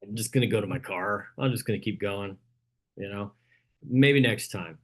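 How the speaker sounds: inner excuse voice. Retold or imitated. Coach voicing a student's inner excuse, mildly reluctant. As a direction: play the reluctant voice in your head. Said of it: "conversation in your brain like".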